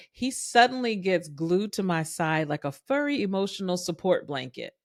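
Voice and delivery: usual speaking voice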